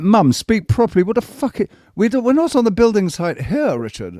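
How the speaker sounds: high, loud indignant comeback; hammed-up outraged teenager; mock indignant retort. Answering his mum at the table, loud and indignant. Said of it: "I'm like".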